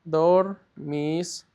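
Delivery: DORMISTEIS drawn out slowly in separate syllables. Shown as a demonstration. Teacher sounding out a new verb form for the class, syllable by syllable. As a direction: say "Dormisteis" as three slow, spaced pieces.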